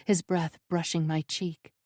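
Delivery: plain reading voice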